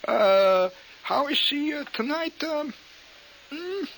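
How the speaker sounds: high, halting fake German; mock German accent. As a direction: fumble for words in German accent. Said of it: "everything I say is in a German accent".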